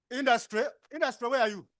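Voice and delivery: loud, high summons; calling out. Real, not acted. Facilitator summoning the industry people, loud call. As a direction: shout the group name to find them.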